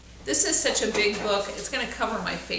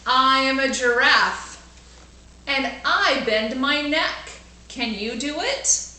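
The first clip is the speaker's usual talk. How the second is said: put-on bright, louder storybook reading for kids; lively read-aloud; 4 semitones higher, 5 dB louder than usual. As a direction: read it out big for the class.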